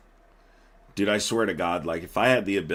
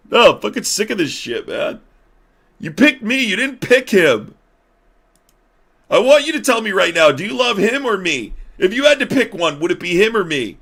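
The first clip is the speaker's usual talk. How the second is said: high, loud jealous-lover demand; J D Vance; 13 semitones higher, 8 dB louder than usual. Acted. Vice president making president choose, mock jealous shouting. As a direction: yell an ultimatum, aggrieved and whiny.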